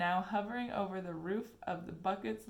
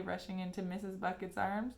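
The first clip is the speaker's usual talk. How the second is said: ordinary delivery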